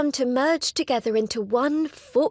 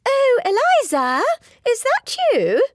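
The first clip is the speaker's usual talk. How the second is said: put-on much higher, louder sing-song old lady call; high-pitched sing-song; 11 semitones higher, 5 dB louder than usual. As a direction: trill it out like a gushing old lady. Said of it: "in a high-pitched sing-song voice".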